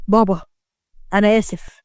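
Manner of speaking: low, sorrowful apology; sad. A narrator's reading. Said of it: "يونس بص لبابا بحزن". (بحزن)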